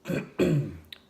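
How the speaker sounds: short throat clear. Real, not acted. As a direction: clear your throat once. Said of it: "clear your throat".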